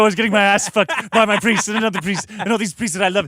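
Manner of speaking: Absolutely Fabulous style camp send-up; campy British parody. Pretend. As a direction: ham up a flamboyant Brit, darling. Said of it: "Absolutely Fabulous".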